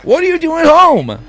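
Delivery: high, loud fussy mom; shrill-pitched sitcom mom. Acted. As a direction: squeal it like a flustered mother. Mom voice resumes.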